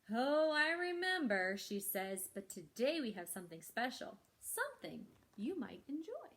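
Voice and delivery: lively, expressive picture-book reading; reading to children. Acted. Librarian reads the story to children, animated storytelling. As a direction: bring each line to life for the kids.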